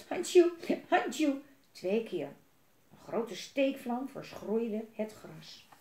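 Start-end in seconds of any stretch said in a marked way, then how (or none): 0.1-1.4 high squeaky sneeze sounds, a little louder; a little dragon sneezing twice. A narrator's reading. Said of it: "piepte luid en nieste".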